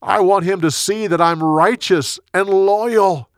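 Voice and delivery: calling out. A narrator's reading. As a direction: declare it across a distance. David still calling over to Saul.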